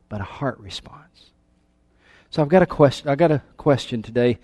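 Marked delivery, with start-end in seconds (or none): 0.1-1.3 hushed. Acted. Hushed emphasis in sermon.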